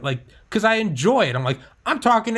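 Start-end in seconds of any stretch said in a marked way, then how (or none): 0.0-1.4 higher, louder excited line; excited raised self-talk; psyched up. Retold or imitated. Psyching himself up. 1.9-2.4 much higher, louder excited burst; high-pitched excited self-talk; psyched up. Retold or imitated. Psyching himself up.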